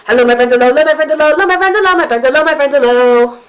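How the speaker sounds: rushed loud chant, racing through; as fast as possible. Shown as a demonstration. Teacher races through the hello song with kids. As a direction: rattle it off at top speed, loud. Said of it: "as fast as we possibly can".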